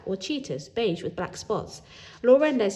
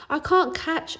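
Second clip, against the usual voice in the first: put-on a child's voice, lifted higher; anxious child voice; 9 semitones higher, 4 dB louder, slower than usual. A narrator's reading. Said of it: "I said privately".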